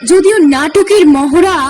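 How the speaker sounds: usual speaking voice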